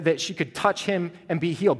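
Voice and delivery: normal talking voice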